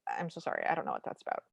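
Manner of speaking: quietly imitating an awkward apology; hushed apology. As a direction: murmur an embarrassed apology, half-voiced. Gail apologizes aside to the judge.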